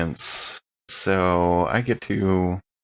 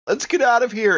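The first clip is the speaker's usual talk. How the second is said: urgent character line; 14 semitones higher, faster than usual. Retold or imitated. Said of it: "use his special move".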